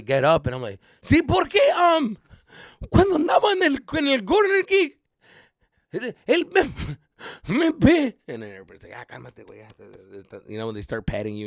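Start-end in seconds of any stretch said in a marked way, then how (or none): 1.0-8.1 high, loud sobbed stammer; choked-up crying. Retold or imitated. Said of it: "I couldn't speak". 8.9-10.4 soft, low calming murmur; calm down an upset friend softly; soothing. Retold or imitated. Teammates calming the crying kid.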